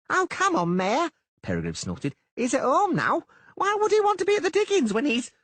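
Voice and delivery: very high, loud reedy merchant; squeaky excited merchant; Peregrine voice. Acted.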